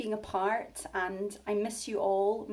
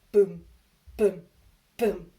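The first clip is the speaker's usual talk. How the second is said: booming footsteps; 2 semitones lower, 8 dB louder, slower than usual. Acted. Footsteps sound effect.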